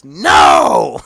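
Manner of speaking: a loud, blunt mock "No!"; mock shout. Host imitating Alicia denying it, loud mock shout. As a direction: bark the denial out loud. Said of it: "she clearly goes".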